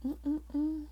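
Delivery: high, soft approving hum; an appreciative little mm-mm-mm; pleased hum. Real, not acted. Humming at a tarot card.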